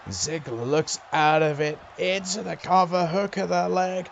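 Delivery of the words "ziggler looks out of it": normal talking voice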